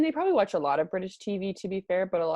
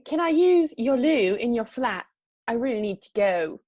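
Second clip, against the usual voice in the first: slow, raised British accent; 4 semitones higher, 6 dB louder than usual. Shown as a demonstration. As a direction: draw it out in put-on British. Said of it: "the most British slang I could think of".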